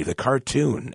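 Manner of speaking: ordinary talk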